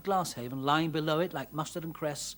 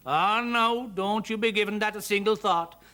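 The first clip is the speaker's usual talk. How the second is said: loud, higher Irish voice, brushing it off; hasty Irish reassurance, voice raised; quick reassurance; 5 semitones higher, 6 dB louder than usual. A narrator's reading. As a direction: jump in loudly to wave the worry away. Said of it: "said Mister Plunkett quickly".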